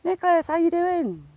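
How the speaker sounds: high, loud sing-song hello to a bird; cooing greeting. Real, not acted. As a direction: call the bird's name up high and sweet.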